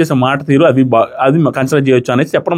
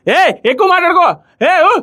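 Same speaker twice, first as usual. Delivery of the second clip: mocking taunt; 11 semitones higher than usual. Retold mocking taunt in fight.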